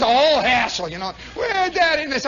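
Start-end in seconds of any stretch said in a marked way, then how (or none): 1.3-2.0 put-on kid nagging. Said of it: "kid nagging again".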